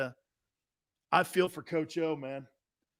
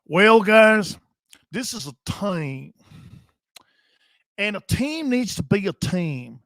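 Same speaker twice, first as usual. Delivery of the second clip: Jerry Jones; 4 dB louder than usual. Acted. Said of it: "I'm acting like a redneck".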